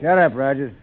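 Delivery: very loud, cutting him off; angry snap. Acted. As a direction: shut him up hard. Boss silences his man.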